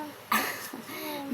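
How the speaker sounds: a soft high sob caught in the throat; half laugh, half cry, overwhelmed; tearful sob-laugh. Real, not acted. Apologizing to viewers, a soft tearful sob-laugh. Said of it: "oh, sorry ha".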